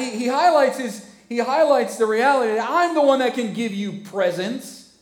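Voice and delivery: high, loud fervent preaching. Acted. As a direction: drive the point home. Preaching at full force.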